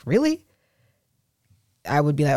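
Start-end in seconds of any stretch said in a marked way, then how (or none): 0.1-0.4 skeptical really. Retold or imitated. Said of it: "and I'm like".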